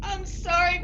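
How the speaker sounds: ordinary delivery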